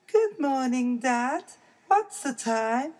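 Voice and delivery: high little cub voice; a small child bear, chirpy; baby bear voice. Acted.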